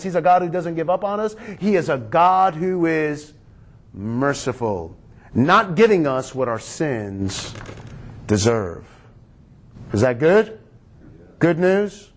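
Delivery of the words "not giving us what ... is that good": normal talking voice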